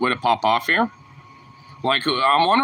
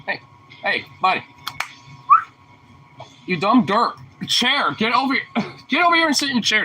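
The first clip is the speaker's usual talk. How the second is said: calling the dog; 10 semitones higher than usual. Real, not acted. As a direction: call the dog over, a little exasperated.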